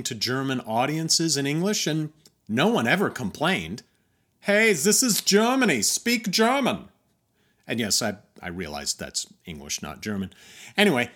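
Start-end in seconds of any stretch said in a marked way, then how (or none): 4.4-6.9 higher, louder hammed-up complaint; mock indignant demand. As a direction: play the offended local, loudly and half in jest. Mock German audience demand.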